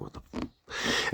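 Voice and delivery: slow whispered disbelief; stunned whisper. Retold or imitated. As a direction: whisper it, dumbfounded. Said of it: "I was like".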